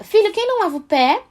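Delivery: pergunta bem aguda, subindo no fim; pergunta à criança. Acted. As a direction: convide o filho pequeno a responder. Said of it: "a gente fala". (mãe perguntando ao filho com voz aguda)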